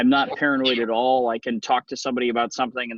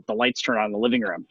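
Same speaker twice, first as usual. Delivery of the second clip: normal talking voice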